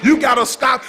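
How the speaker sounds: fervent preacher's proclamation; full-force preaching. Real, not acted. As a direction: preach it up to a climax.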